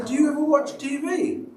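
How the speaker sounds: pitched high, scoffing question; dismissive retort. Retold or imitated. Husband scoffing at his wife over swearing, pitched high. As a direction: brush her off with a scoff.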